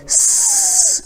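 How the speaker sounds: long high whistling s hiss. Shown as a demonstration. Teacher demonstrating the whistle of sin, whistling hiss. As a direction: push the breath out like a whistle. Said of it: "سیٹی کی طرح آواز جیسے نکالتے ہیں".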